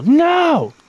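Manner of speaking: sudden squeaky high shout of no; mock outburst. Cuts off awful beetle option.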